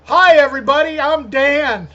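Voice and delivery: loud, high mock-host greeting; big showy hello to the listeners; podcast host intro. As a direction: belt out a hammed-up show opening. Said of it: "let's try it right now".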